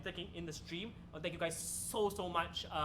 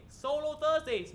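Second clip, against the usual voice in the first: show announcer; 7 semitones higher, 7 dB louder, slower than usual. Acted.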